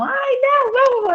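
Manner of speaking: high, eager pleading; excited urging. Retold or imitated. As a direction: mimic relatives begging her along. Family urging to go again. (imitando a família, empolgada e aguda)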